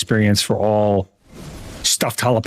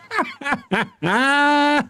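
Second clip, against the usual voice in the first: laughing. Real, not acted. Laughing at the sub message.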